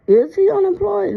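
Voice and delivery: incredulous. Real, not acted. Incredulous echo of the question.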